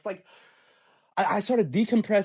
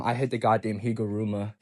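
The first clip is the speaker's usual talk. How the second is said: ordinary delivery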